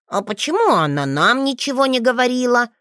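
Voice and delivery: put-on a boy sulking at his mother's secret; a touch of a wounded child; offended. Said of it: "Обиженно насупился Лео".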